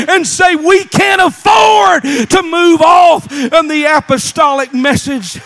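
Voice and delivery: full-force preaching. Real, not acted. Preacher urging a church to hold to apostolic truth, full-force preaching. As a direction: preach it hard, punching the key words.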